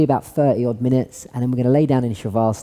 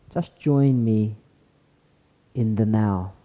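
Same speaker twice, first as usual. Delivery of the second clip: guided meditation; 2 semitones lower, 3 dB quieter than usual. Acted. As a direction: gently guide them into stillness. Leads a seated meditation.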